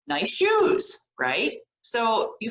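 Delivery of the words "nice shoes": friendly compliment. Shown as a demonstration. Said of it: "one of the compliments we had was".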